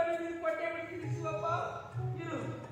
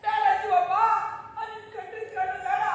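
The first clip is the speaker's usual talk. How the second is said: loud distressed outcry with a broken laugh; alarmed cry; 3 semitones higher, 8 dB louder than usual. Acted. Devotee cries out at Shiva's bleeding eye. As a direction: shout in alarm at the hurt eye.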